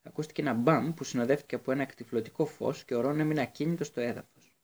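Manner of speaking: plain reading voice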